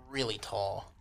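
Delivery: ordinary delivery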